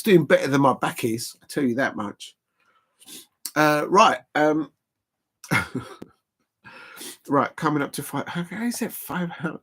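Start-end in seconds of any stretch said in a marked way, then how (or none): none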